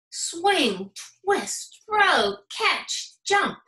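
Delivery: high, punchy action calls; energetic. A narrator's reading. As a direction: bounce each word like a gym move. Energetic gym action words.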